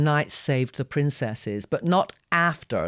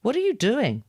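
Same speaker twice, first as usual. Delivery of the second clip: puzzled question; 10 semitones higher, 4 dB louder than usual. Acted. Girl baffled by the boy.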